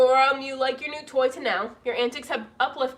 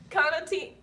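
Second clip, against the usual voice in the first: ordinary talk, nothing marked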